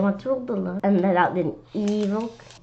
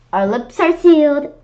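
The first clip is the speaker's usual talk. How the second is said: eager promise; 6 semitones higher, 8 dB louder than usual. Acted. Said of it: "Andrea said".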